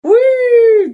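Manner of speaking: very high, loud, stretched wee; Mario-style "wee" squeal. Retold or imitated. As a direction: squeal it like a game sound effect. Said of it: "って言ってて". (甲高く伸ばした「ウィー」)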